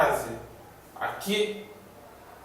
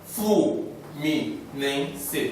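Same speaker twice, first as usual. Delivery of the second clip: flu, Mi, Nem, Se, uma sílaba por vez com pausas; separando sílabas; much slower than usual. Shown as a demonstration. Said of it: "Tem mais de três sílabas". (sílabas de Fluminense ditas separadas)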